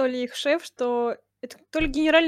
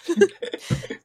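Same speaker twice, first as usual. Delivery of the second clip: high unvoiced laugh; burst of laughter; 7 semitones higher than usual. Real, not acted. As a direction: laugh it out breathily. Laughing at the song line.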